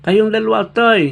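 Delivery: put-on loud, high call from an old man. Old man calling the two strangers on the road, loud. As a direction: shout over to the two strangers.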